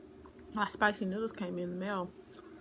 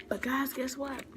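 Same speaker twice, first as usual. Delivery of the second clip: excited whisper; 5 semitones higher than usual. Real, not acted. Teasing news to viewers.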